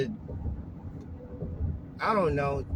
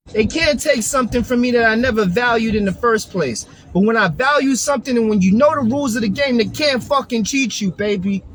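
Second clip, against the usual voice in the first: loud, heated New York accent; 8 dB louder than usual. Real, not acted. A loud New York accent, heated in his car. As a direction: Brooklyn swagger at full volume. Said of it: "Hear that New York accent?".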